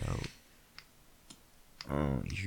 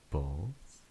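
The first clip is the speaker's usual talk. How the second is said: ordinary talk, nothing marked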